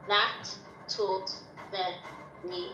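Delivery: slowly spelling out a line of the poem; slow reading aloud. Real, not acted. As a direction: drag each word out slowly. Child slowly reading her line.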